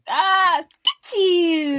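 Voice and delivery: high, loud comic exclamation; hammed-up squeal; put-on character voice. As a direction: pitch it up and call it out. Performs the fun request line.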